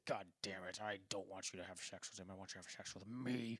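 low, hushed imitation of a husband; mock marital argument. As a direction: grumble it softly, playing the husband. Acted quote of arguing husband.

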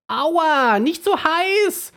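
high, loud, slow toothbrush complaint; scolding toothbrush. A narrator's reading. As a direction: yelp and scold like a scalded little brush. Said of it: "schimpft die Zahnbürste".

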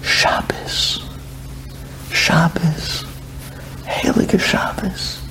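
slow reverent whisper, repeating Shabbos; awed whisper of a holy word. Retold or imitated. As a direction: breathe each Shabbos like a prayer. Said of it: "I hear him whispering".

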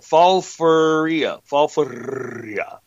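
sounding out the name slowly. Real, not acted. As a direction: drag each syllable out. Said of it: "I can't remember the name".

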